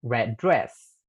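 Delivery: "red dress" joined into one word, the d sounds merged; linked consonants. Shown as a demonstration. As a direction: run "red dress" together with no break. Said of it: "Pueden repetir". (unir "red dress" como una sola palabra)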